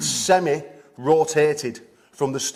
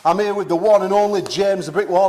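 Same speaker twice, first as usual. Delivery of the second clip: high, loud, hyped-up host opening; raised, punchy seminar welcome; event announcer intro; 7 semitones higher, 5 dB louder than usual. Real, not acted. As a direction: announce it big to the crowd. Host opens the live seminar.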